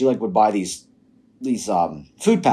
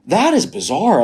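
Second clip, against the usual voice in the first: higher, louder amazed quote; retold astonishment; 9 semitones higher, 5 dB louder, slower than usual. Telling a friend about the dog spitting out peas, astonished. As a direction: re-enact being baffled, pitched up with force.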